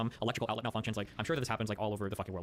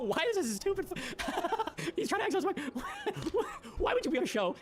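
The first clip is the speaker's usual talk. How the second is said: high-pitched laughter through the words; laughing disbelief; slower than usual. Real, not acted. Laughing at moderator's hand rule.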